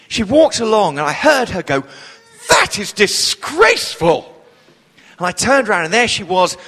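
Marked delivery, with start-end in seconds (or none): 2.5-4.3 high, slow, indignant exclamation; outraged lady. Retold or imitated. Wonderful woman overheard at the blocked seats, outraged. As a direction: play the scandalised theatre-goer, pitch leaping up. Retold outraged theatre-goer.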